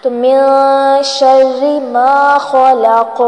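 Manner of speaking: slow, drawn-out tajweed chant; Quran recitation. Acted. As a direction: recite each verse slowly with long held vowels.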